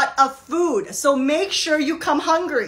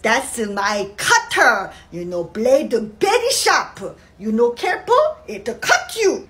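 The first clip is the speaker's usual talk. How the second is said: put-on Korean mom accent; 3 semitones lower than usual. Korean mother at security.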